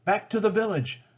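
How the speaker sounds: commanding. Acted. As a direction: order the warriors back. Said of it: "Kukum commanded".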